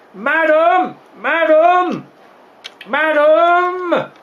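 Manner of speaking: high, drawn-out calls for the mistress; sing-out hail with long endings; calling out. Acted. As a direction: summon someone from another room.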